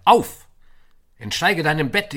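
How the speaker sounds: loud high shout. Acted. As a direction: bellow him out of bed. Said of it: "rief er". (lauter, hoher Weckruf)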